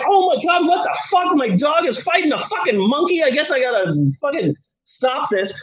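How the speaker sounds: high, alarmed outburst at the dogfight; mock shock that trails into resignation; hammed-up panicking king. King sees dog fight monkey.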